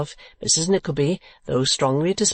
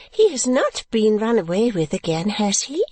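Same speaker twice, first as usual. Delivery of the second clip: higher, lightly voiced playful question; teasing little woman; 8 semitones higher than usual. A narrator's reading. Visitor asking after her friend, teasing. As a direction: ask it high, teasingly. Narrator voices Miss La Creevy.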